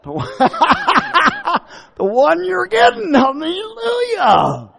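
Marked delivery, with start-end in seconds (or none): 1.9-4.7 laughing. Real, not acted. Preacher laughing, exclaiming.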